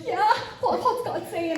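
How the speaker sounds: high, hopeful imitation of an expectant listener; eager mock questioning. Woman asks the prayer leader for God's word, imitated eagerly. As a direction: lift the pitch, eagerly pressing for an answer.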